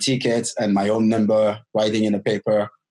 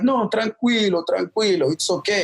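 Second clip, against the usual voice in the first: reassuring nuns; 7 semitones higher than usual. Retold or imitated. Nuns on the train reassure the lost boy, mildly higher and soothing. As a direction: soothe him like a kind nun, in a lightly higher voice. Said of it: "They were like".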